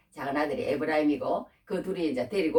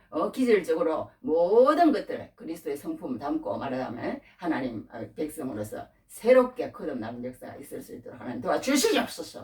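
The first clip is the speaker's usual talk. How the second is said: fervent prayer; 4 semitones higher, 4 dB louder than usual. Acted. Preacher models Jacob's ideal prayer.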